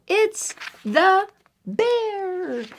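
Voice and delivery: title stretched out slow and high, ominous; dramatic title. Shown as a demonstration. Reader teasing the title to the children, slow and spooky. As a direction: draw the title out to build suspense. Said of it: "It's the Bear by Jez Alborough".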